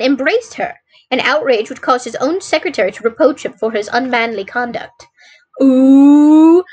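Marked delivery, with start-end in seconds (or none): 5.6-6.6 long, loud rising "ooh"; hammed-up ooh of scandal; mock-scandalised "ooh". Real, not acted. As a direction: draw out a loud, teasing ooh. Reader breaks off to mock the phrase.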